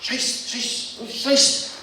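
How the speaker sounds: breathy German Scheiss, each one voiceless; muttered German curse. Retold or imitated. German doctor realising she has the wrong patient, breathy curse. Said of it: "looks around ... and says".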